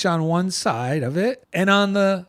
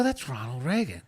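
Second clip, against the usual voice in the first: hushed recognition; 4 semitones lower, 9 dB quieter than usual. Retold or imitated. Retelling the same recognition.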